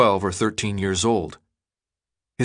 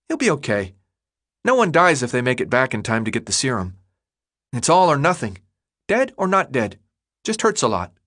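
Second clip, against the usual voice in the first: put-on a know-it-all kid, breezy and quick; chubby kid Chuck; 2 semitones higher, faster than usual. Chuck explains the serum.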